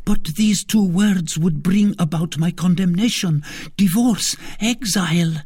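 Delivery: put-on high, earnest young woman voice; eager young maid. A narrator's reading. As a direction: offer help with conviction. Maid offering help.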